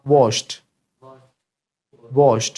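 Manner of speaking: WASHED ending in a light t; soft final t. Shown as a demonstration. Teacher modelling for the class, soft final t. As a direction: say WASHED as "wosht", short and falling. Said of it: "you have to add T also with it".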